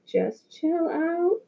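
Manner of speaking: slow, soft, soothing and calm. A narrator's reading. Brother calms upset Joey before he snaps, soft and slow. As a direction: settle him down gently. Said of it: "I spoke in a calm voice to Joey".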